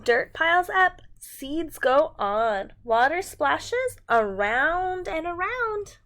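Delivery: slow, emphatic read-aloud with big sing-song swings; animated storytime voice; reading to children. A narrator's reading. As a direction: read it out like a picture book for little ones. Reading picture book to children.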